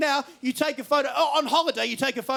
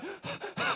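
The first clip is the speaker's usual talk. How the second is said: asthmatic gasp; 10 dB quieter than usual. Retold or imitated. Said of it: "I hear her go".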